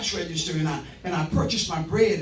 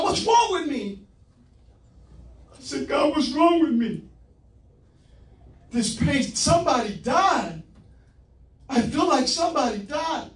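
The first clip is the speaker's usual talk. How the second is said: anguished cry to God; 6 semitones higher than usual. Retold or imitated. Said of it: "I'm sobbing".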